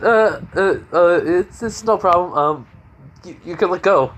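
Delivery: high, loud nervous stutter; acted embarrassed fluster; flustered stammering. Flustered by an unexpected hug.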